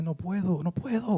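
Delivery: un toque de pánico imitado, subiendo al final; la esposa que no puede calmarse, apenas actuado; imitando angustia. Retold or imitated. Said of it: "Y ella temblando". (imitando suavemente a la esposa temblorosa)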